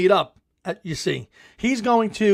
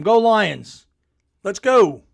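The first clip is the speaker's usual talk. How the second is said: cheering; 2 semitones higher, 5 dB louder, much slower than usual. Real, not acted. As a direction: pump up the crowd, loud. Cheering for Detroit before kickoff.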